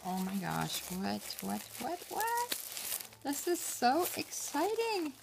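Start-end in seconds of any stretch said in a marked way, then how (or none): 1.8-5.1 high, slow squeal of delight over a gift. Real, not acted. Crafter squealing to her friend over a gift, high and delighted.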